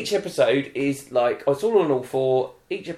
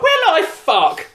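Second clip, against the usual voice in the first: high, loud, drawn-out retort; indignant shout; 13 semitones higher, 5 dB louder, slower than usual. Retold or imitated. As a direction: re-enact yelling back at the telly in indignation. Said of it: "I've never shouted at the telly".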